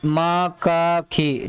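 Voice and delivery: मक्खी stretched very slowly, sound by sound; slow syllable demo. Shown as a demonstration. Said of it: "ठीक है, मक्खी". (मक्खी को तोड़कर, खींचकर बोलें)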